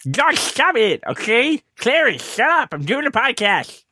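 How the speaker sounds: hammed-up scolding of the dog; redoing it at the dog Clarence on request, mock yelling. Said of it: "Would have been better if you yelled at the dog that way".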